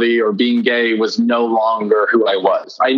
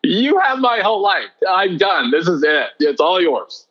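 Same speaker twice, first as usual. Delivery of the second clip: higher, earnest, emotional plea; fervent surrender; 6 semitones higher than usual. Retold or imitated. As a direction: give your whole life away, fervently. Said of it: "and I said".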